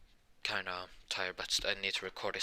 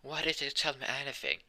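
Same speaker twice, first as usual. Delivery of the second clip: mildly mad, higher-pitched question; mock a touch of indignation, pitched up; kinda mad; 6 semitones higher than usual. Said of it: "then she'd be kinda mad".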